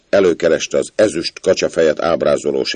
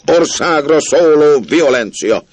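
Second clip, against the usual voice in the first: slow, loud, much higher outburst; outraged; 9 semitones higher, 5 dB louder, slower than usual. A narrator's reading. Old squire rages about the outrage, loud and slow. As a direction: explode at the scandal. Old man raging at the scandal.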